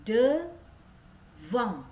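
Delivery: "Devant" with nasal end "devoŋ"; French nasal "devant". Shown as a demonstration. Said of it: "Dibacanya devant".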